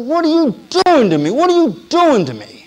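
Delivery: high, loud, anguished outcry at heaven; angry cry to God. Retold or imitated. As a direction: shout it up at God, hurt and furious. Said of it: "I was just crying out to him".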